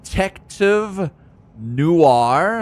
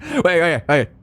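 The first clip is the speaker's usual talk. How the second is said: ordinary delivery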